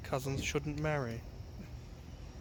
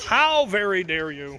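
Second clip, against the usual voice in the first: mock outrage; 4 semitones higher, 8 dB louder, slower than usual. Mock outrage at redhead joke.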